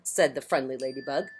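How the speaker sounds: plain reading voice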